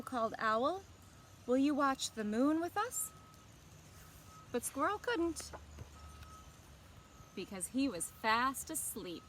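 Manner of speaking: gentle, expressive storybook reading; picture-book narration to young listeners; reading to children. A narrator's reading. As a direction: read it like story time for kids.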